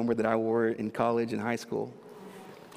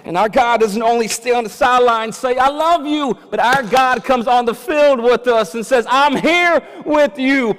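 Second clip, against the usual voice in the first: loud, high preaching climax; full-force preaching; 11 semitones higher, 13 dB louder than usual. Acted. Pastor to congregation, preaching at full force. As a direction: build it to a roaring close.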